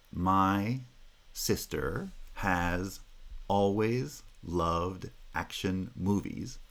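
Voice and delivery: slow, each stress marked; stressed rhythm. Shown as a demonstration. Teacher slowly modelling the stress rhythm for students. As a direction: tap out the sentence rhythm slowly. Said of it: "the natural rhythm for that".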